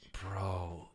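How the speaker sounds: hushed whisper of disbelief. Real, not acted. Telling friends about the kettle, whispering in disbelief. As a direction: breathe it out, barely voiced.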